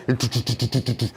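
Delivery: rapid-fire "t-t-t-t" gun noise; imitated Gatling gun. Host mimics the navy's Gatling guns, mock gunfire. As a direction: rattle off a mock machine gun.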